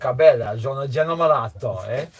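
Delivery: loud, hammed-up Italian charmer; mock Italian accent. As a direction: lay on the Italian accent for fun. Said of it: "Or Italian, Italian, not French".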